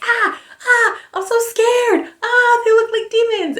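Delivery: high-pitched mock fright; mock scared. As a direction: squeal like a scared heroine. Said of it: "the human girls are always like".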